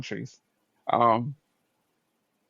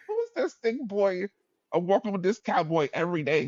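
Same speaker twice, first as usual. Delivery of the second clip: lightly voiced curious onlookers, pitched high; onlookers' question; 7 semitones higher than usual. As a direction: mimic puzzled students in a higher voice. Voicing gossiping students' question.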